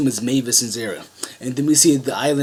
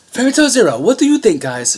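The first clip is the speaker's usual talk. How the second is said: higher and louder, hyped question to the viewers; excited outro; 8 semitones higher, 6 dB louder than usual. Real, not acted. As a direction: pump up the audience about Fairy Tail Zero.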